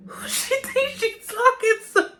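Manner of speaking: high, loud, slow indignant cry with a laugh; outraged disbelief. Retold or imitated. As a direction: shriek it in offended disbelief, laughing. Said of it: "I was mad".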